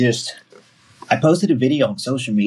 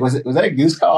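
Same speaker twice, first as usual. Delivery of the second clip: quoted surprise; 7 semitones higher, faster than usual. Retold or imitated. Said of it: "I was like".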